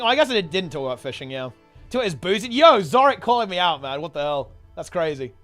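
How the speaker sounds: ordinary talk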